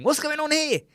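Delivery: high-pitched baffled outcry; mock bewilderment. Viewer watching the game, high mock bewilderment. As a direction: burst out high, as if totally lost.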